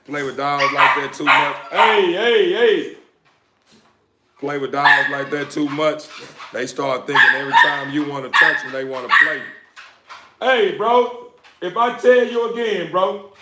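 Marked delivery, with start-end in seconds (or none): 1.7-3.0 sharp high yell at the dogs. Real, not acted. Yelling at dogs to stop. 10.4-13.3 high, raised warning to the dog; scolding the dog. Real, not acted. Scolding dog from across room.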